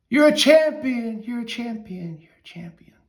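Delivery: proud call that echoes into silence; shout fading to whisper. Acted. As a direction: call it out, then echo it off into a whisper. Father shouts praise, echo fades.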